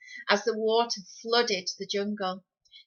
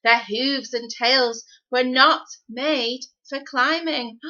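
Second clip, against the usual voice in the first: usual speaking voice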